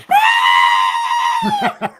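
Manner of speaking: high shrieking yelp of fright; terrified scream. Retold or imitated. Muscle man fleeing the ghost, high terrified scream. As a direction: scream like a big man losing his nerve. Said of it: "dropped his K two meter and went".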